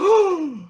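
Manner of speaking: big, high theatrical gasp; mock shocked gasp. Son shocked by mother's demand, theatrical gasp. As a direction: gasp as if scandalized.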